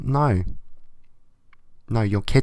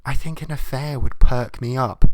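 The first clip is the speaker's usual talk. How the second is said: loud, higher sing-song mock of the writer; mocking the letter writer; 4 semitones higher, 8 dB louder than usual. Comic reading a letter, mocking the cheating writer, loud mock voice. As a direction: sneer it out as the silly cheater.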